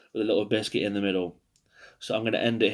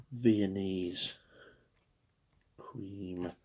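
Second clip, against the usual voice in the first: whispered savouring; 3 semitones lower, 11 dB quieter, much slower than usual. Pretend. Savouring the biscuit name.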